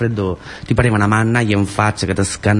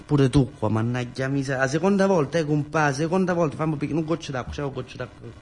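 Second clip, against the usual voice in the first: much softer, still shaken from the scare; shaken after fright; 4 semitones higher, 8 dB quieter than usual. Acted. Scolding the friend who gave him a fright, softly shaken.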